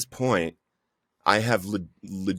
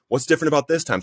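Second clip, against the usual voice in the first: high-pitched mock yell at God; raised, exasperated retold protest; retold angry yelling; 7 semitones higher, faster than usual. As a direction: demand it angrily, reenacting a yell without much volume. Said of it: "So I'm screaming and I'm yelling at God".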